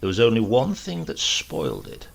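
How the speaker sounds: ordinary delivery, nothing marked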